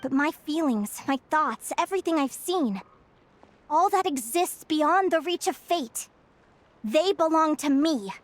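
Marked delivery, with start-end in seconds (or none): none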